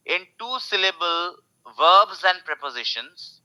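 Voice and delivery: ordinary talk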